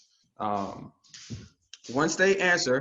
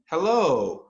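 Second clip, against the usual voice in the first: bright lifted Hello with the pitch going up; upbeat rising hello; 4 semitones higher, 5 dB louder than usual. Shown as a demonstration. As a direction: say Hello with an upward lift. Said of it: "you need to go upward".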